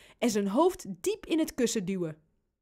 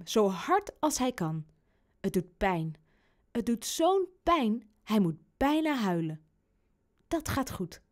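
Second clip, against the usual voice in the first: ordinary delivery, nothing marked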